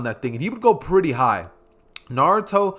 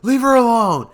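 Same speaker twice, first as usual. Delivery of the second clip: loud, high, drawn-out protest; angry shout; 8 semitones higher, 8 dB louder than usual. Acted. Naruto confronts the kids bullying Hinata, angry shout. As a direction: shout it angrily to protect someone. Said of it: "he would get immediately angry".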